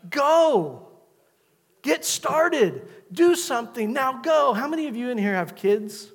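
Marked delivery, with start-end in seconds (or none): 0.1-4.5 loud, high booming command; forceful divine order; God commanding. Acted. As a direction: thunder it out as God sending Moses.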